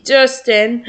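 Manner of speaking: usual speaking voice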